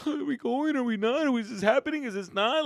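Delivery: high fretful questions; anxious kid. Retold or imitated. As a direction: worry like a sleepless kid.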